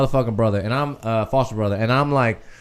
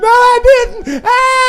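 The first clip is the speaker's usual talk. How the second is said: high, loud wailing cry, much higher than his usual voice; hammed-up terrified sob; retold scared crying; 4 dB louder, much slower than usual. Boy sobbing at the family chasing the car. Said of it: "crying in the car and shit".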